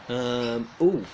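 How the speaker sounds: usual speaking voice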